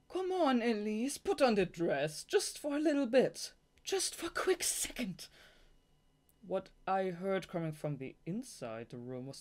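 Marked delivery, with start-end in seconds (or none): 0.1-3.5 high, loud wheedling plea; whiny pleading boss. Acted. Boss begging Elise to put on the dress, high whiny plea. 3.8-5.3 high breathless whispered plea; whispered wheedling. Acted. Boss coaxing Elise in a hushed whisper, wheedling.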